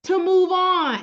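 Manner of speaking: put-on a fed-up parent hurrying a child along; exasperated God. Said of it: "He's like".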